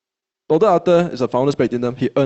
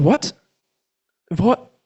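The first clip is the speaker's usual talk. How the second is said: high, stunned double what; shocked disbelief; 5 semitones higher than usual. Retold or imitated. Hearing his friend bought elsewhere, high shocked disbelief.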